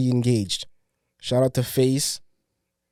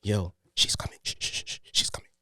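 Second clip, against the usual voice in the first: urgent whisper; 5 semitones lower, 8 dB quieter than usual. Retold or imitated. Retelling shushing at the party.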